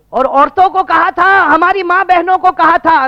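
high, loud pulpit cry; full-force preaching. Acted. Preacher reproving the congregation about women, full force. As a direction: drive it home at full voice.

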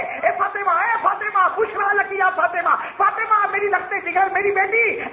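high, jubilant calling out; joyful. A narrator's reading. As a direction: call a beloved daughter with good news, elated. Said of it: "خوشی میں بدل گیا". (خوشی سے بلند آواز میں پکار)

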